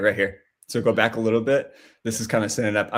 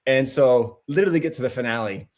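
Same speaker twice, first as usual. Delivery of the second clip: usual speaking voice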